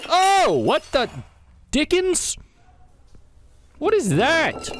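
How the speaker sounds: high, loud startled outburst; shocked yelp. Real, not acted. Shocked by a strange sound.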